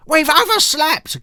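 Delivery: high, loud, slow wail. Acted. Little girl wailing to her brother they've overslept. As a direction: wail it in dismay. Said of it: "Ketchup Face wailed".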